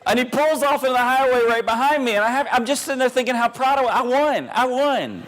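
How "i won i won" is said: gleeful gloating. Retold or imitated. Gloating after beating the minivan.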